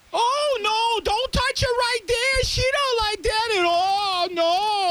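high, slow cheering mom voice. Pretend. Mom cheering her daughter on in the joke scenario, high and slow. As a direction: coo and cheer like an overexcited mom.

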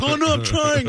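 high-pitched imitated excuse; mock flustered protest. Friend defending his bad photography, mock flustered. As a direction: act out a helpless friend pleading his case.

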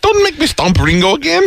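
high, put-on English lady; prim lady threatening in falsetto. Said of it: "Lady English lady".

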